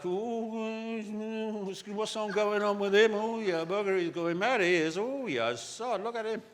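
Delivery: gossiping workmates. Acted. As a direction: tut over a colleague in a mock busybody voice. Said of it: "or their people at work".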